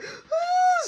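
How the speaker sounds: imitated crying howl; hammed-up whine; high mock wail. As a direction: let out a high childish wail. Imitates boy's angry cry.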